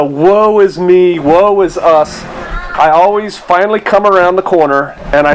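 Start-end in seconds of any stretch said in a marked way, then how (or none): 0.0-2.2 mock lament. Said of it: "whenever I start thinking this way".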